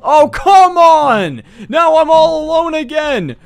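loud, high, slow groaning yell; yelling at the cop who locks him in; frustrated shout. Acted. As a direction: drag out the come on in frustration. Locked back in the cell.

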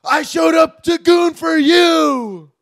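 high, slow furious yell. Acted. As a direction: scream it at her in the car. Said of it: "Jake Gyllenhaal ... yelling at his wife".

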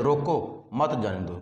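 रोको set apart, then मत जाने दो as one phrase; pause after रोको. Shown as a demonstration. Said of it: "कि इन्हें रोक लो". (रोको पर रुककर, फिर मत जाने दो)

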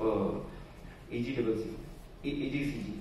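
usual speaking voice

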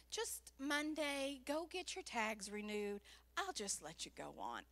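low, quiet imitation of a policeman; soft, deep, easygoing authority; lenient officer. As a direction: give a gentle instruction, voice dropping. Voices officer letting her off.

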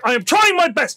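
imitating the driver. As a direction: snap it back, done with the argument. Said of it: "He was like".

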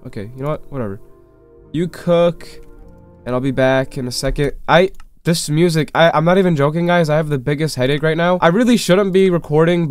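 usual speaking voice

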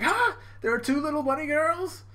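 very high startled gasp into a question; shocked discovery. Retold or imitated. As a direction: act out a cartoon double take.

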